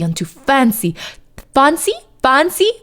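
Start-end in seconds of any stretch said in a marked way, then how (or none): 1.5-2.7 British accent. Shown as a demonstration. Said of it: "say that in a British accent".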